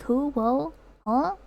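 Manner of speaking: ordinary talk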